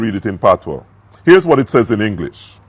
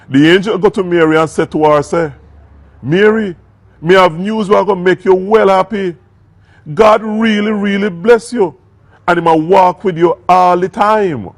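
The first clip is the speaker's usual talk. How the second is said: higher, louder patois read, lively and proud; Jamaican patois reading; 7 semitones higher, 4 dB louder than usual. Shown as a demonstration. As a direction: read the angel's words in full Jamaican patois. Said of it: "read that same passage in our Jamaican New Testament".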